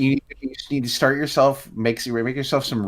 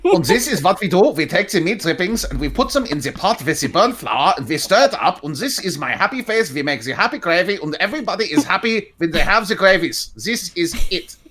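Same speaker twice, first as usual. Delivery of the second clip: put-on mean German accent; 7 semitones higher than usual. S1 answers a request for a mean German gravy demo, hammed-up German accent. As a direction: play a stern German chef, mock-serious. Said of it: "the mean German accent?".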